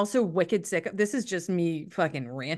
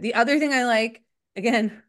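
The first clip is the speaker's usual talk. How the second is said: normal talking voice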